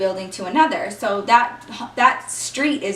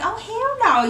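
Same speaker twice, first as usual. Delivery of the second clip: mock outrage; 10 semitones higher than usual. Reacting to crying ex.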